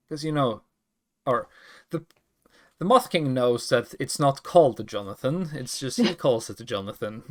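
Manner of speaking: ordinary talk, nothing marked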